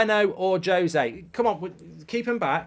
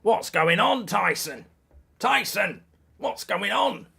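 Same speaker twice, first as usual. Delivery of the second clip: gravelly boxing dad, raised pitch; Tyson Fury's dad; 6 semitones higher, 4 dB louder, slower than usual. Shown as a demonstration. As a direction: call out to your son in gravel. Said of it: "sounding like Tyson Fury's dad".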